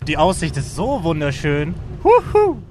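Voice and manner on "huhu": excited call. Real, not acted. Waving from glider in air.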